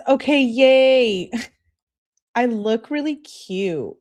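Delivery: high, drawn-out "yay" with a laugh; excited mock cheer. Girlfriend texting that she's ready for pickup, mock-excited. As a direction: gush it slowly, imitating an excited girlfriend.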